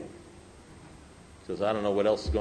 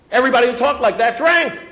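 much louder, high-pitched hammering repeat; emphatic shout; 13 semitones higher, 13 dB louder than usual. Real, not acted. Speaker warning fellow alcoholics who drank, emphatic shout. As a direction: shout the point home to the room.

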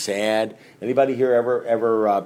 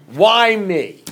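higher, whiny poor-me voice; self-pitying whine; 7 semitones higher, 5 dB louder than usual. Retold or imitated. Recovering drinker mocking his own self-pity, whiny and higher. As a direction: whine like the world is against you. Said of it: "feeling sorry for myself".

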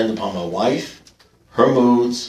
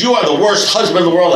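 mock exasperated wife; 7 semitones higher, 5 dB louder than usual. Wife complaining, quoted in story.

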